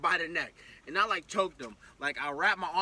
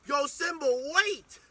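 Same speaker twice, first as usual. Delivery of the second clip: sharp, higher call to the dog; 7 semitones higher, 3 dB louder, slower than usual. Retold or imitated. Owner calling his running dog to wait. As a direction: yell to stop the dog.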